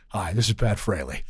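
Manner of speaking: low, easygoing introduction in a borrowed voice; Pat Fraley voice. Shown as a demonstration. Actor finds a mentor's voice for colleagues, low impression. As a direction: drop well down into the impression. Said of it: "find my Pat Fraley voice".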